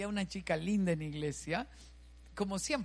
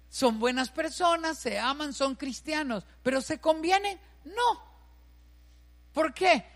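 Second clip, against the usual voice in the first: louder and higher, pressing each question home; loud preaching; 6 semitones higher, 6 dB louder than usual. Real, not acted. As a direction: drive it home to the crowd. Preacher repeats rhetorical questions loudly.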